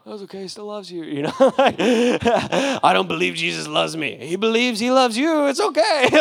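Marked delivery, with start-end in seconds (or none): 0.0-1.0 higher, soft gentle reassurance; soft, reassuring and higher. Retold or imitated. 1.4-4.2 slow, loud, higher laughing mock; slow laughing imitation; mock guard. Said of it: "quoted guard's objection". 4.2-6.1 much higher, louder sing-song; bright high-pitched reassurance; cheerful insistence. Retold or imitated. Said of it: "reply to guard".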